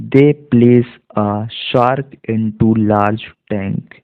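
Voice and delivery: very slow, word by word; slow reading demo. Shown as a demonstration. Teacher rereads the sentence slowly for students. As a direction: drag out each word like reading to a beginner. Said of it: "देखिए".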